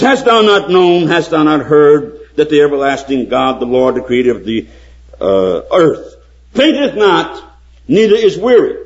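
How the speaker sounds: high, loud preaching of scripture; fervent pulpit reading. Acted. Quoting Isaiah, preaching.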